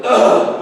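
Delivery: short guttural mock groan of a sulky kid; caveman noise. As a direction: grunt it out like a grumpy child. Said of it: "we call it the caveman noise".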